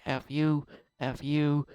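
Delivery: half-joking rant that swells at the end; mock fed-up outburst. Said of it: "I'm like".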